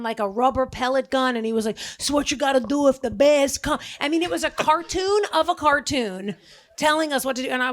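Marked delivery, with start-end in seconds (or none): 2.0-3.8 put-on New York accent. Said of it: "didn't he have a thick New York accent".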